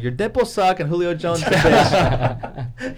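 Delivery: slow insult breaking into laughter; laughing trash talk. Retold or imitated. Trash-talking a friend, slow laughing insult. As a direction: roast him while cracking up. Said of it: "I'm like".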